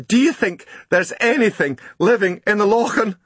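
high, breathless Scots voice, pouring out the story; dripping postman tells Grumble his boat sank, high and upset; shaken Scottish postman. Acted. Postman telling of his sunk boat, pitched high with alarm. Posty asks about the Lochan.

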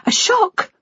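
very high, disbelieving echo; bitterly incredulous daughter; incredulous scoff. A narrator's reading. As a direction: throw the word back in high-pitched disbelief. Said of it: "A laugh escaped her".

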